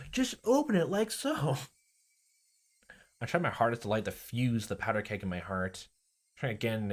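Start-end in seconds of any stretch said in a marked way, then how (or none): 0.1-1.6 high, slow sweet pleading; imploring Rena. Acted. Rena imploring Keiichi to unchain, high and slow. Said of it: "imploring me to remove the chain".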